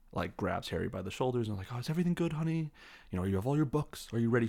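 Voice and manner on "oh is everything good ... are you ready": high, doting mother voice, lightly done; motherly fussing. Retold or imitated. Mrs Weasley checking on Harry, a light imitation of motherly fussing. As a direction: fuss over him like a caring mum. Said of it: "She's very maternal with him".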